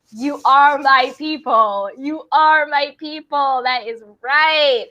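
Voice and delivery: rally the crowd like a hype woman. Real, not acted. Host hyping her conspiracy-loving viewers, loud and slow.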